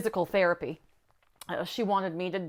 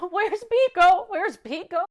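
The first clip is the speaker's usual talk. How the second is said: high, urgent repeated call; child calling out; 11 semitones higher, 7 dB louder than usual. Retold or imitated. Scared little sister calling for the cat. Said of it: "She was calling for the cat".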